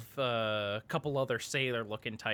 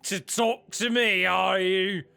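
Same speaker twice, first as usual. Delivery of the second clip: higher, needling dragonborn captain; challenging taunt; 6 semitones higher, 5 dB louder, slower than usual. Acted. Dragonborn captain confronts the silent suspect, taunting. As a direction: taunt them for staying silent.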